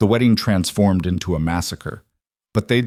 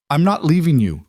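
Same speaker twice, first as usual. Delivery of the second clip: defiant raised shout; shouting back; 8 semitones higher, 3 dB louder than usual. A narrator's reading. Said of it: "Elena shouted back".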